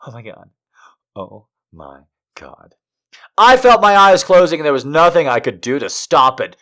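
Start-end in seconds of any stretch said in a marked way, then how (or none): 0.1-2.7 breathless whisper. Acted. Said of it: "breathless whisper".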